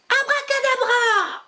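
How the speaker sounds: very high declaimed magic word; declaimed spell. Acted. Witch casting the moon spell, very high declaiming voice. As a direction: proclaim the spell grandly. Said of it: "déclama".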